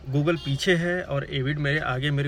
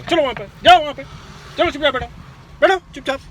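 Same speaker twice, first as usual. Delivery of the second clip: higher, louder barked orders; firm raised-voice commands; stern commands; 8 semitones higher, 4 dB louder than usual. Real, not acted. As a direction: order the pets back to their seats. Ordering the pets to sit.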